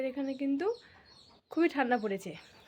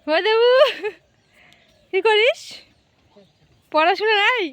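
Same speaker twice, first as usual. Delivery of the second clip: high, loud call to brother; shouting out; 8 semitones higher, 10 dB louder than usual. Real, not acted. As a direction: yell across to him. Calling to brother outside.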